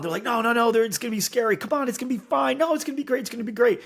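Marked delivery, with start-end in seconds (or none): 0.2-1.6 high-pitched protest of a nervous first-timer; mock freaking out. Friend afraid of the roller coaster, mock freaking out. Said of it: "they're, like, freaking out". 1.6-3.8 very high, insistent cajoling; eager convincing. Acted. Convincing someone to go on a roller coaster, eager coaxing. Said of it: "you're the one that convinced them".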